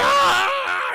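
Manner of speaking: loud, high mock shriek of agony; pained scream. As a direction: scream as if badly wounded, hammed up. Voices man whose arm explodes.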